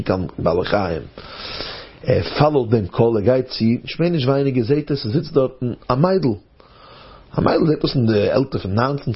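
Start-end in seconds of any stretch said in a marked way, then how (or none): none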